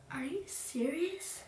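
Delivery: high, drawn-out incredulous question; acted disbelief. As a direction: stretch it out high in mock disbelief. Reacting to cheeky birthday request.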